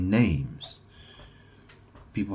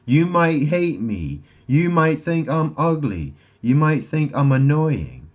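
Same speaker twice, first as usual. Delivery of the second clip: high, loud, insistent monologue; dramatic declamation; 6 semitones higher, 7 dB louder, slower than usual. Acted. Telling listeners they can't live without me, dramatic declamation. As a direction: proclaim it forcefully, pitched up.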